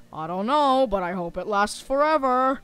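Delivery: usual speaking voice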